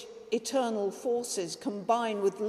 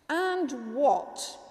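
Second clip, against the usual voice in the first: severe; 5 semitones higher, 3 dB louder than usual. A narrator's reading. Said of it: "inquired the Lord severely".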